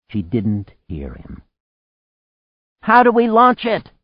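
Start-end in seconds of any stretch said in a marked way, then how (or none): 2.8-3.9 high, loud yelled question; crying out. A narrator's reading. Anne asks about launching the boat, crying out. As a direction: call it out urgently in a raised, high pitch. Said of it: "Anne cried".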